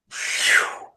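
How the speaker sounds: soft airy "phew" of flight; voiceless swoosh for a glider taking off; imitated whoosh. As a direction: blow a quick breathy whoosh.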